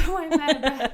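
loud laughing mock gasp; mock herself, laughing through it; laughing quote of her own panting; laughing self-mockery. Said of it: "I'm like".

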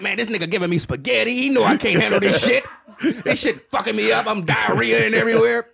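high complaining dog voice. Retold or imitated. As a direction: voice the dog griping about food. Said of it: "That's my dog voice".

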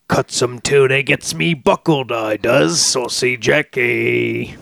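slow hammy Cockney villain; bad Cockney accent, drawn out. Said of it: "probably be doing some bad Cockney accents".

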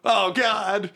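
hammed-up panic, rising as it goes; panicked victim. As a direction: realize with dread what's happening. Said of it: "and he's like".

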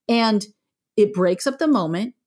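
ordinary delivery

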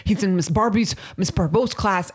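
tripping over the teacher's name; retold fumbling. Mother retelling a panicky voicemail to school, fumbling the words. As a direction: stumble on the name, then correct yourself. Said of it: "started fumbling every other word".

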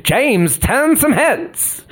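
put-on slow, rising old-timey announcer; fifties reporter voice. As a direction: sell it like a fifties newsreel. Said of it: "I keep imagining".

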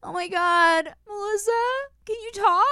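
very high, hammed-up weepy wail; badly faked breakup sobbing; fake crying. Girlfriend fakes a breakup call to rescue Melissa, fake crying. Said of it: "pretend that you just broke up with your boyfriend crying".

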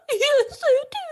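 high-pitched mock sobbing; fake crying. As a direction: wail it like a horrible crier. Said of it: "She's a horrible crier".

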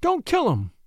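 high-pitched, urgent plea; pleading. A narrator's reading. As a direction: beg for his life in a high voice. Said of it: "pleaded Missus Delancey".

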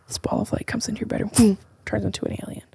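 very soft, half-whispered narration; hushed storytelling with a soft sound effect; hushed scene-setting. Pretend. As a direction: whisper it like a mystery unfolding. Narrating an imagined alien visit.

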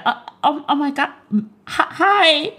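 high-pitched, starstruck stammer; nervous and awkward. Pretend. Host imagines meeting her married crush, nervous and awkward. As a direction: play tongue-tied meeting your crush, pitch way up. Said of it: "I'd be so nervous and awkward".

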